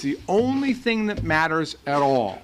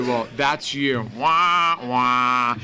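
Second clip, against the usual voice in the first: Debbie Downer moan; 3 semitones lower, much slower than usual. Retold or imitated. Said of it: "this character called Debbie Downer".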